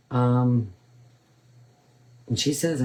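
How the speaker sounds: usual speaking voice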